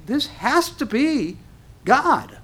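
slow, high, amazed declaration; awestruck conviction. Acted. A dazzled believer declaring the sign is from God, slow and high. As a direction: draw it out in wonder. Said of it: "you're gonna say".

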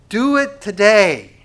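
slow, loud, high plea; crying out. Acted. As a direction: cry it out slowly and forcefully. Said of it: "is crying out to you and I".

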